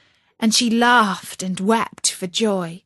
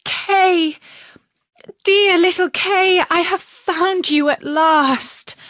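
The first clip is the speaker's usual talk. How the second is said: high, loud tearful cry of joy; 10 semitones higher, 6 dB louder than usual. A narrator's reading.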